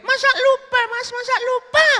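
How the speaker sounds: loud, very high falsetto; protesting woman. Acted. Woman to the man who forgot her, protesting falsetto.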